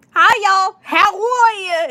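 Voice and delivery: put-on high, loud farm-girl accent; big drawn-out Alabama farm-girl greeting; Bridget. Shown as a demonstration. Collector in character as her doll, cheerful farm-girl accent. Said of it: "I give her a farm girl accent".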